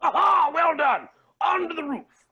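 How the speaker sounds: high, loud cry of triumph; triumphant. Acted. Max leads everyone to the roof, triumphant. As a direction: exclaim it, rallying everyone onward.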